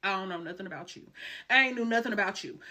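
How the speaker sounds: fast, insistent denial; hurried insisting she knew nothing. Retold or imitated. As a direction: rattle it off quickly.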